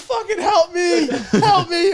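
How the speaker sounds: high, loud pleading through laughter; desperate cry for help. Retold or imitated. Dustin on the bathroom floor begging the guys for help, laughing shout. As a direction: cry out for help while cracking up. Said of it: "he goes".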